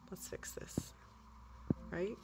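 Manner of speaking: breathy whispered aside. Real, not acted. Vlogger rearranging her toy tower, whispering to herself. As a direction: whisper it, concentrating on the fix.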